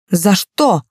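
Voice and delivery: mildly indignant question; narrated, understated indignation. A narrator's reading. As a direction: protest with a touch of outrage. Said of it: "ожидаемо возмутился Гарок".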